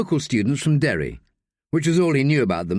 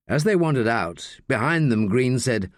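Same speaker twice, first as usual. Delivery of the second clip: ordinary delivery, nothing marked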